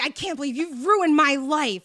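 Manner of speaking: retold angry accusation. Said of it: "he's just like".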